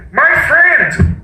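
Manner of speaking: high, projected little voice; tiny mayor. Acted. Mayor calls to Horton from the speck, high voice. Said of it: "came the voice".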